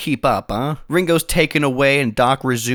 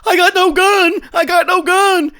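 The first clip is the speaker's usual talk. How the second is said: high, loud, squealing terror; panicked Ike; 6 dB louder than usual. Acted. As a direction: shriek it high as a cowering coward. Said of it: "Ike's like".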